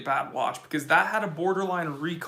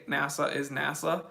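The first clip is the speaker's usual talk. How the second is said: normal talking voice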